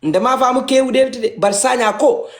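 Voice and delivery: normal talking voice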